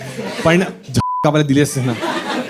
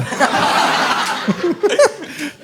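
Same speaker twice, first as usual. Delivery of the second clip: airy laugh; 11 semitones higher, 5 dB quieter than usual. Real, not acted. Breathless laugh after kiss dare.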